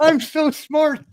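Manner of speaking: high, impish leprechaun squeak. Pretend. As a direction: chirp it like a cheeky leprechaun. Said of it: "I sounded like a leprechaun there".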